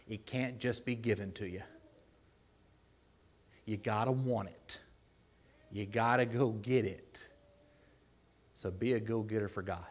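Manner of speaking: usual speaking voice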